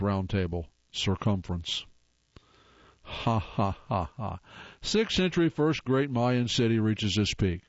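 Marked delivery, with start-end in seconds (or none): 3.0-4.4 dry, deliberate ha-ha; spaced-out, half-hearted laugh; mock laugh. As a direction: fake a laugh at a bad joke. Dry laugh at own bad joke.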